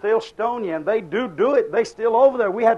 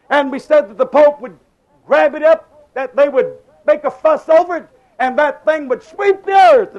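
louder, pushing preacher warning; preaching; 4 semitones higher, 6 dB louder than usual. Acted. Preacher warning the congregation about the Pope, loud. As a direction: drive the prophecy home.

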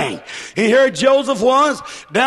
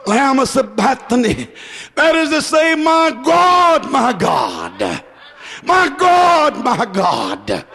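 anguished cry. Acted. Preacher voicing Jesus on the cross, anguished cries. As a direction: wail the name in anguish.

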